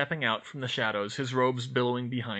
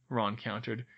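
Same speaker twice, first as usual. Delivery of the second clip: ordinary delivery, nothing marked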